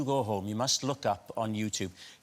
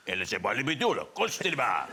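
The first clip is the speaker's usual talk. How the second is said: light mock Spanish dub voice; lightly hammed-up Spanish dubbing voice; sexy Spanish voice; faster than usual. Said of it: "very sexy Spanish voice".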